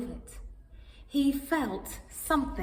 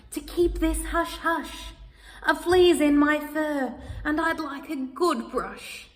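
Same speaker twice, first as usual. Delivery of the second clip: lightly voiced cat; 5 semitones higher than usual. Cat asks for secrecy.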